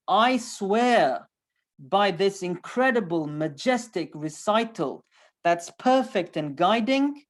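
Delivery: slow, weighty declamation; grand oath with strong, drawn-out stresses; emphatic oath. Acted. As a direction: swear it forcefully, stretching the key words. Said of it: "Why all this emphasis?".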